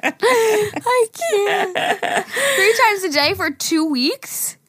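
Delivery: high, squeaky laughing protest; helpless laughter. Real, not acted. Host cracking up at the listener's email, helpless laughter. Cracking up over three times daily.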